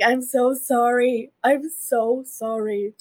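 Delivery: slow, drawn-out groveling; mock apology. A pregnant woman apologizing to her own mom, slow and groveling. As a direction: plead it slowly like you mean it. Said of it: "I'm like".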